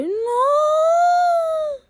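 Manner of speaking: high shouted no; anguished cry. Real, not acted. Player sees the dragon hit, a high anguished shout. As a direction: cry out in horror.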